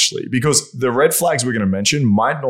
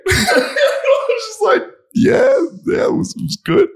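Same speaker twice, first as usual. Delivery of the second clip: squeaky laugh through the words; slower than usual. Real, not acted. Telling his friend the story, laughing high. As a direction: laugh so hard the voice goes high. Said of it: "laughing while quoting himself".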